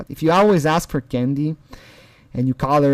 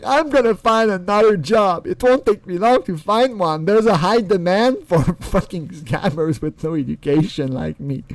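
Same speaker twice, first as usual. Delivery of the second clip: higher mocking quote, settling down; mock job-seeker; 4 semitones higher than usual. Mocking his promise to find another job, light mock voice. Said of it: "now he's like".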